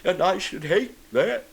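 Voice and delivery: high, woeful bear voice; sorry little storybook bear; sniffly bear. A narrator's reading. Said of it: "sniffing slightly".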